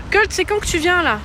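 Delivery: loud impatient call; hey, when are you coming. Real, not acted. Calling out to chat.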